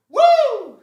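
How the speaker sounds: loud squealed Woo with a laugh; high Woo cry. Retold or imitated. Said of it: "it would always end with".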